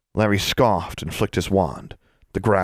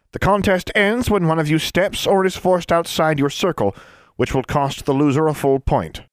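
formal announcer; 10 semitones higher than usual. A narrator's reading. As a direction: proclaim the rules grandly. Hoster explains rules.

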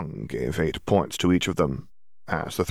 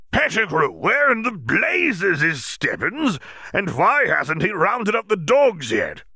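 Bagman shouting; 12 semitones higher, slower than usual. Acted. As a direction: bellow across the field at a slack underling.

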